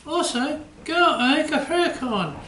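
the puppet chimes in eagerly. Acted. Puppet George repeats the suggestion.

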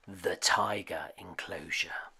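suspenseful whisper at the tiger enclosure. A narrator's reading. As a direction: breathe it like a secret. Suspense in the story.

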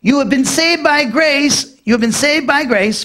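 emphatic scripture reading, leaning on "saved". Shown as a demonstration. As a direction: drive home "You have been saved" with slow, high-pitched emphasis. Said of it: "The Greek is emphatic".

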